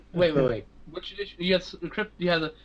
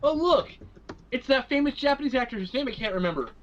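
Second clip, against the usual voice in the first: mock clueless tourist; 7 semitones higher than usual. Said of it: "Chinatown and going".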